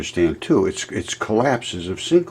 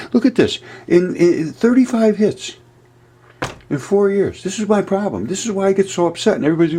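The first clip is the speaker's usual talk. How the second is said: higher, pitched-up frustrated complaint; upset; 10 semitones higher than usual. Real, not acted. Complaining to viewers about few video views, upset. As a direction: vent the hurt of being ignored. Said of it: "That's why I'm upset".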